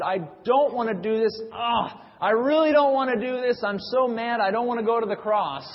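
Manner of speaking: loud, high whiny protest that keeps building; grumbling complaint. Pretend. Jesus pictured complaining on the way to the cross, loud and whiny. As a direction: grumble it like a sulking child refusing a chore. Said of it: "And did it grumbling".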